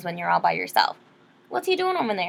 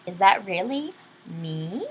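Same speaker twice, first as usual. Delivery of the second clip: plain reading voice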